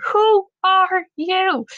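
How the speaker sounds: high, slow, drawn-out mock scream. A narrator's reading. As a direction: scream each word separately, WHO ARE YOU. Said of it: "she screamed over and over".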